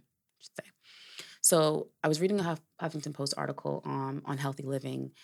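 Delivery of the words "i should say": breathy under-the-breath correction; whispered aside. Real, not acted.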